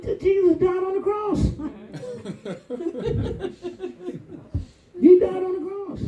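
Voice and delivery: preacher shouting to the church that Jesus died on the cross; preaching shout. Real, not acted. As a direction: cry it out to the congregation. Preacher shouting the gospel point.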